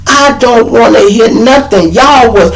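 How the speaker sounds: high, forceful cut-off; mad. Acted. Miss Washington refusing to hear explanations, mad and high. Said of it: "she was mad".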